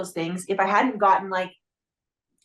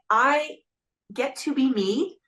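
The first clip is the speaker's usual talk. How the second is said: normal talking voice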